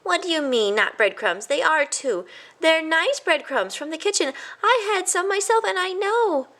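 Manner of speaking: high, insistent voice; protesting mouse. A narrator's reading. A cousin protests the crumbs, high and firm. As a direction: argue back firmly.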